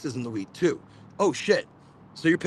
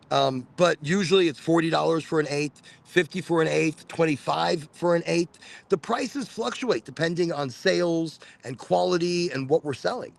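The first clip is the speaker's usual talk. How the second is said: usual speaking voice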